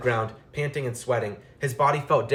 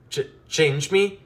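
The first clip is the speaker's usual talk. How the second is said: high, slow, stammering squeak; scared young mouse squeaking; frightened squeak; 6 semitones higher, slower than usual. A narrator's reading. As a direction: voice cracking with fear. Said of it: "he squeaked, his voice cracking".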